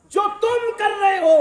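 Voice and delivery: sudden loud, high cry; preacher's loud high rebuke; rebuking shout. Real, not acted. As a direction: hit the crowd with a sharp high call.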